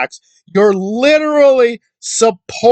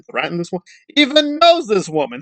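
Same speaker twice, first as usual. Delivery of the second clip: usual speaking voice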